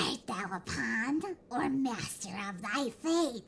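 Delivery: slow, soft little dragon; baby dragon voice. Pretend. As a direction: rumble it out like a tiny dragon. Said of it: "He gets a baby dragon voice".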